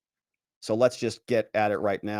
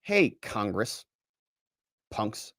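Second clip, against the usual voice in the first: slow, soft, deadpan sneer; mock tough taunt, slow and softer; mock tough-guy aside; 2 semitones lower, 5 dB quieter, much slower than usual. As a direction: drop it quiet and slow like a taunt. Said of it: "He writes".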